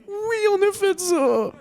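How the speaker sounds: high, slow, crying confession; wailing admission. Acted. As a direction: whine it out like a guilty child. Ashamed admission to French man.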